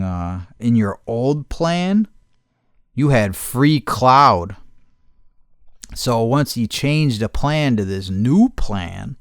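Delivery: slow, careful agent talk; slow support-agent explanation. Retold or imitated. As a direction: spell it out slowly, like tech support. Tech support explains the lost cloud.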